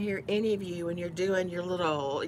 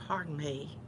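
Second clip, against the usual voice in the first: ordinary talk, nothing marked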